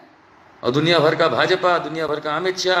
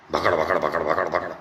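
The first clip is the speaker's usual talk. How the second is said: mock chatter noise; 12 semitones lower, 3 dB quieter than usual. Mocking Modi's endless chatter.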